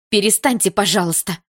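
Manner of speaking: loud, high plea to stop; sudden outburst. A narrator's reading. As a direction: break in sharply over the uproar. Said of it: "вдруг ударила журналом по столу".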